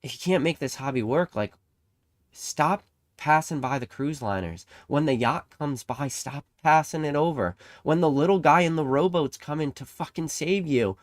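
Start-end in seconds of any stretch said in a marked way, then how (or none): 2.3-11.0 climbing louder and higher toward a shout; building rant. Real, not acted. Vlogger urging hobby viewers, rising to an impassioned near-shout. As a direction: hammer the point home, getting heated.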